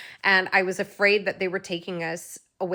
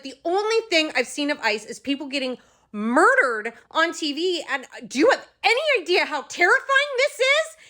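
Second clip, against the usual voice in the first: terrified outburst; 9 semitones higher, 5 dB louder than usual. Retold or imitated. Pleading, terrified outburst at officers.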